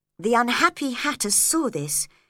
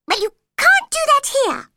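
very high, loud cross squeak; cross Dormouse; 10 dB louder than usual. A narrator's reading. Dormouse scolding Alice, very high and cross. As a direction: snap at her like an offended mouse. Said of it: "said the Dormouse crossly".

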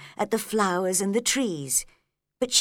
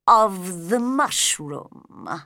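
slow caterpillar voice; 4 dB louder, much slower than usual. A narrator's reading. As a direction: drag each word out lazily. Caterpillar answers thought.

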